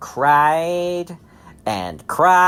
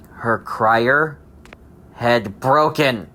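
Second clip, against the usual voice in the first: ordinary delivery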